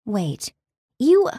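sudden high gasp on you; gasped realization. A narrator's reading. Narrator realizing the demon reads her mind, gasping. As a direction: catch it mid-breath, shocked. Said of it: "I gasped".

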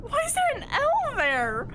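high-pitched, incredulous outcry; indignant protest. Real, not acted. Corrected on salmon by friends, high indignant protest. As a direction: protest it, voice shooting way up.

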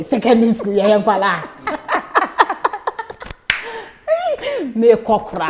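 laughing through the words, high and loud; cracking up at the memory. Real, not acted. Telling the host she ran away, laughing loudly. Laughing while retelling running off.

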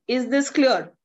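ordinary delivery

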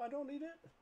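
high, soft, silly self-talk; mock high voice. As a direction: play the little voice of reason, high and thin. Arguing with himself over card.

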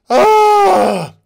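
high, loud exasperated yell; frustrated scream. Real, not acted. Vlogger screaming in frustration at the show, loud. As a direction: let out a fed-up scream.

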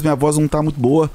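ordinary delivery

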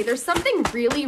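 ordinary talk, nothing marked

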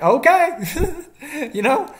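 high burst of laughter; laughing. Real, not acted. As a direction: laugh it off in disbelief. Laughing at the absurdity.

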